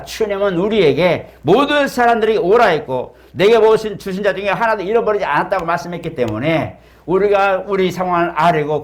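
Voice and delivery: high, driving pulpit proclamation; impassioned preaching. Real, not acted. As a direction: preach it at full force to the congregation. Preacher rises to full-force proclamation. (열정적으로 외치는 설교)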